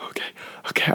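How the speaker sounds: breathy, nervous whispered reply. Retold or imitated. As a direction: whisper it like a scared kid. Nervous boy before performing.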